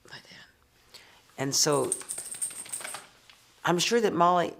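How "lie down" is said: barely audible whisper; very soft, voiceless order; whispered command. Real, not acted. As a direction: whisper it to the dog as an aside. Repeats command to her dog.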